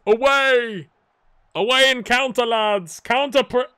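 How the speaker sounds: loud urgent shout to the players; real shout of urgency; yelling at players. Real, not acted. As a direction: bellow it at the pitch. Yelling at his team on screen.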